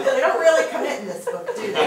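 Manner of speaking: loud, high, amused jibe through laughter; laughing. Real, not acted. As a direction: tease the book while laughing. Student jokes amid shared laughter.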